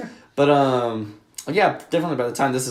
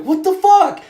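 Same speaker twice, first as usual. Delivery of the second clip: high, louder imitated disbelief; incredulous outburst; 5 dB louder, slower than usual. Friend caught out over his own podcast, imitated incredulous outburst. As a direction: pitch it way up in mock indignation. Acts friend's outraged reaction.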